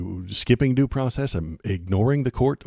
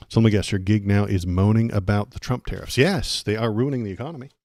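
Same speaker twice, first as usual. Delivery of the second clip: usual speaking voice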